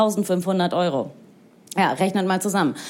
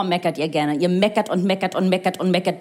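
usual speaking voice

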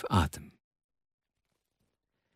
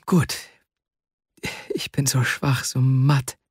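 faint and weary, halting for breath; mildly breathless, with pauses between phrases; struggling for breath. A narrator's reading. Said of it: "um Atem ringend".

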